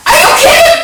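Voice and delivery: high-pitched squealed disbelief; exasperated, shooting way up high; incredulous. Real, not acted. Exclaiming at own stupid mistake.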